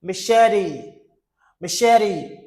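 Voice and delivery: "Machete" as mi-SHE-di; flat T. Shown as a demonstration. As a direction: say "Machete" with a flapped T. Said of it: "the T sounds like D".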